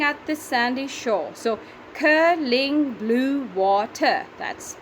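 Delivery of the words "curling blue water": "curling blue water" in slow, separate beats; syllable by syllable. Shown as a demonstration. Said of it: "curling two".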